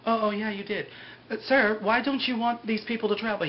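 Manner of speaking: a hint of puzzled customer service; lightly voiced airline agent. Voicing the airline woman to Hurley.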